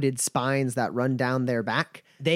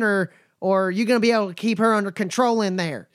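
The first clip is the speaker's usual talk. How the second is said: higher, folksy drawling caravan hand; a wary but friendly trail boss sizing folks up; nomad Bumper; 6 semitones higher than usual. Acted. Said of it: "and goes".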